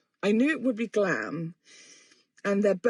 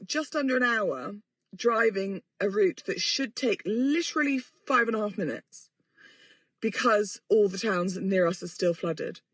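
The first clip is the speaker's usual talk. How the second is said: ordinary delivery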